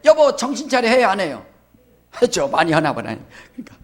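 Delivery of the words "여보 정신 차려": higher mock nag; wife scolding husband. Pastor imitates a wife scolding her husband, higher. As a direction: nag him like a fed-up wife. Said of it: "남편한테".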